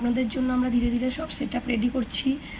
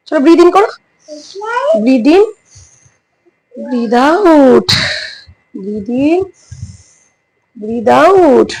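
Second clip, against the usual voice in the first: yoga instruction; 3 semitones higher, 14 dB louder, much slower than usual. Shown as a demonstration. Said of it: "আমি আশিকে yoga করাতে থাকি".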